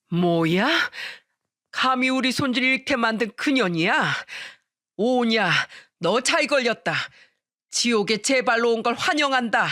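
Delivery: menacing acted fury, rising in pitch; angry mother-in-law. A narrator's reading. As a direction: threaten the one who hurt your family. Mother-in-law turns on tormentor. (분노한 시어머니의 으름장)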